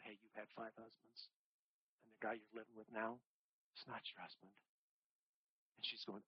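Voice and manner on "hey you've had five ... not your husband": very soft, gentle confrontation; hushed truth. Retold or imitated. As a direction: say it quietly, almost under the breath. Jesus confronting the woman, hushed.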